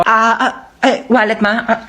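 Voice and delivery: ordinary talk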